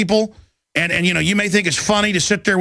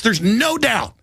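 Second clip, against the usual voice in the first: ordinary talk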